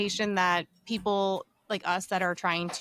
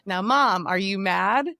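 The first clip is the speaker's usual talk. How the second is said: imitating daughter; 3 semitones higher, 6 dB louder, slower than usual. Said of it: "So now my daughter will go".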